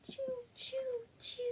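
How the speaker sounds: tiny high-pitched choos; playful choo noises. Shown as a demonstration. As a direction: squeak out little rising-arm sound effects.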